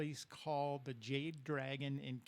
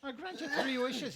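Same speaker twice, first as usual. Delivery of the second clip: slow, high Irish brogue; Irish leprechaun; 11 semitones higher, 5 dB louder than usual. Acted. Leprechaun granting wishes to the golfer, high Irish voice. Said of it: "I also do Irish".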